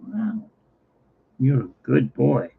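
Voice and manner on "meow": tiny, breathy meow, high and very soft. Retold or imitated. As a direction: squeak out a barely-there cat meow. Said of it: "he didn't put out a full meow".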